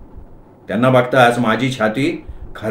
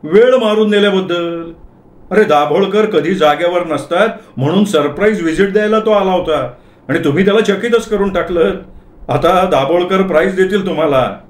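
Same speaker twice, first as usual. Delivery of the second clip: higher-pitched congratulatory colleague; accountant character; 5 semitones higher than usual. Acted. Accountant explaining the inspection to the clerk, high approving voice.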